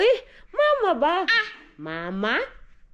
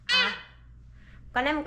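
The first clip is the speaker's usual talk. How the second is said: ordinary talk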